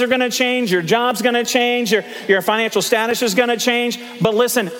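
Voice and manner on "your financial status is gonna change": ordinary delivery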